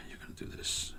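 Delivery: half-whispered while rummaging; whispering. Real, not acted. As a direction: whisper it under your breath. Whispering while searching for part.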